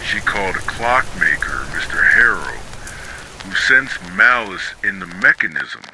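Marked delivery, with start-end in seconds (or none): none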